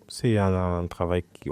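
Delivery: usual speaking voice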